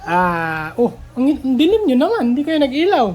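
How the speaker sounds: loud call into a dark house; calling from far away. Real, not acted. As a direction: call out, half laughing.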